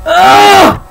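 loud high ugh of disgust; disgusted groan. Real, not acted. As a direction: groan at the taste. Tastes bad jelly bean.

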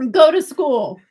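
loud, laughing tease; teasing call. Real, not acted. Mother laughing at her son's geography, loud tease. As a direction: jab him loudly and playfully.

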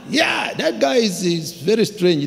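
high, slow, drawn-out "Yeah"; emphatic confirmation. Retold or imitated. Confirming the strange watchman.

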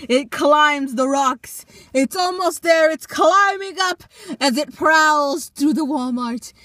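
loud, rising play-by-play; excited commentary. Pretend. Excited commentary as cat climbs.

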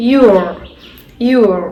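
your said clearly twice; teacher demo of the ure sound. Shown as a demonstration. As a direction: model the sound your for kids. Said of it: "ഇത് ഷുവർ എന്നാണ് അല്ലേ?".

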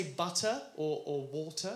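"butter" and "water" with a T, no hard R; British pronunciation of "butter", "water"; British soft T. Shown as a demonstration. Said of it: "I would say".

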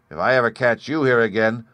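plain reading voice

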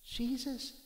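high, soft calling of a name; a soft, lightly voiced plea to Jesus; quiet calling. Woman softly calling out to Jesus. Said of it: "she didn't cry out loud like".

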